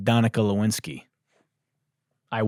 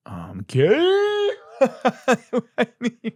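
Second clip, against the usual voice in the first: surprised laugh; 5 dB louder, much slower than usual. Real, not acted. Reading a shocking note.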